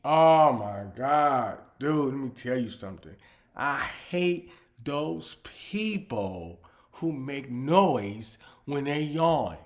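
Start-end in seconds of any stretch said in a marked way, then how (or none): 3.5-9.6 slow emphatic complaint. Acted.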